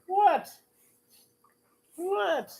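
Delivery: very high squeaky pet voice; talking to a dog. Real, not acted.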